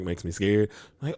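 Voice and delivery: ordinary talk, nothing marked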